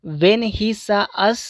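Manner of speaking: "When he saw us" rising on "us"; rising tone demo. Shown as a demonstration. Teacher showing students an incomplete statement, rising tone. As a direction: lift the pitch on "us", leave it open. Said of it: "ये rising के साथ में".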